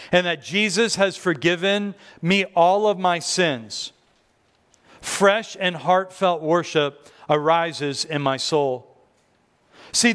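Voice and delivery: ordinary talk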